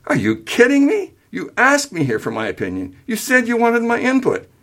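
high-pitched, disbelieving outburst; incredulous. A narrator's reading. Son defends himself to parents, incredulous. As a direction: protest in high, swooping disbelief. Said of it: "Incredulous, William said".

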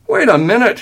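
high, loud interruption; emphatic. A narrator's reading. Father halts the family argument, emphatic. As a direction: break in emphatically. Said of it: "his dad said emphatically".

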